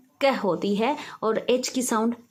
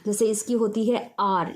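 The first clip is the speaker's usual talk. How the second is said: ordinary delivery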